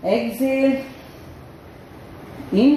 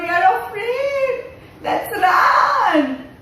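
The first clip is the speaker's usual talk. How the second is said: much higher, panicky cry; mock fright; 11 semitones higher, slower than usual. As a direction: pretend panic to amuse the kids. Fleeing the lion in story.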